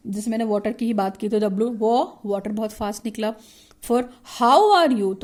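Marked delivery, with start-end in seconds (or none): 3.8-5.2 how lifted high, are you dropped low, slow. Shown as a demonstration. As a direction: sing how up high then let are you fall. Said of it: "तो how बहुत ऊपर हुआ ... are you नीचे चला गया". (how ऊपर, are you नीचे)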